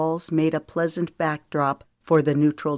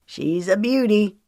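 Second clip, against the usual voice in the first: proud; 5 semitones higher than usual. A narrator's reading. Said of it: "Knox said proudly".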